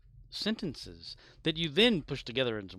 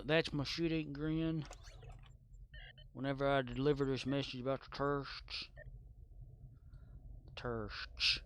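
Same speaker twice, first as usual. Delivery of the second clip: Bush impression; 2 semitones higher, 3 dB quieter than usual. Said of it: "George Bush".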